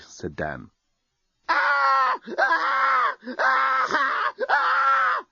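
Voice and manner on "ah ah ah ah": shrill repeated screams of panic; screaming uncontrollably. A narrator's reading. Lucy panics at the alien, screaming nonstop. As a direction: let the screams run wild. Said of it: "continued Lucy".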